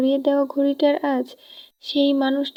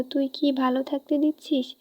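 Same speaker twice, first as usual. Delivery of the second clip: ordinary delivery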